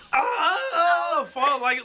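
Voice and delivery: slow hammed-up sobs; fake-cry it; mock sobbing. Mocking his girlfriend's fake crying, hammed-up sobs.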